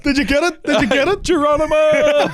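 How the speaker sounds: high, loud whoop mid-leap; hammed-up Geronimo yell, high and loud; mock jump shout. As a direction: cry it out like leaping from a window. Acting out the jump.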